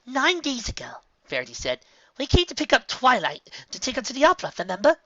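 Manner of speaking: high, bright eager call; excited pony voice. Acted. Character voice in story.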